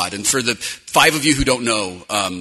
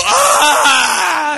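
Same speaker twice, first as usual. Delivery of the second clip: high loud game death yell; death scream; 13 semitones higher, 6 dB louder than usual. Retold or imitated. Soldier dying in game, loud yell. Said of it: "I go".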